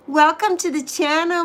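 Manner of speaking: ordinary talk, nothing marked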